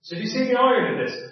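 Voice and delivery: usual speaking voice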